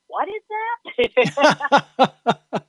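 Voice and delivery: drawn-out, laughing puzzled question; baffled laugh. Retold or imitated. Guest mocking knots on air, baffled and laughing. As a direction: laugh through the confusion, very slowly.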